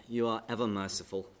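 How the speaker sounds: ordinary delivery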